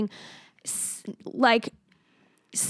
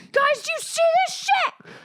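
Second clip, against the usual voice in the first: high, excited streamer voice; excited livestreamer. Pretend. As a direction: hype it to the viewers. Pretend live stream to followers.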